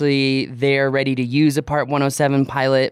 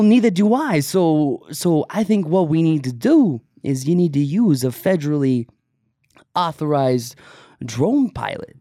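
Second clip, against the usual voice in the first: put-on New York accent; 3 semitones higher than usual. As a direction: play a pushy New York boss with a laugh. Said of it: "you know, that New York accent".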